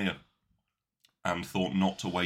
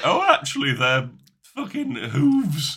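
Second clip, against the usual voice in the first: smug know-it-all; 7 semitones higher, 7 dB louder, slower than usual. Retold or imitated. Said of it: "you were gonna be like".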